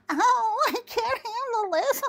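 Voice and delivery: high, wailing mock of someone overwhelmed; mock helplessness. Woman mimicking men faced with female passion, mocking helpless wail. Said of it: "and you go".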